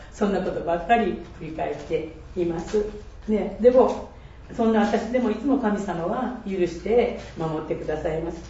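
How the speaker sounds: ordinary delivery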